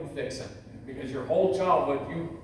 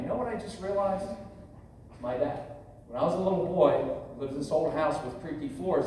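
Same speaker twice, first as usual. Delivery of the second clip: ordinary talk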